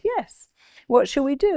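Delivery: high, eager retold reply; acted enthusiasm. Mother inviting daughter to plan a birthday, re-enacted eagerly. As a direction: play up the happy invitation.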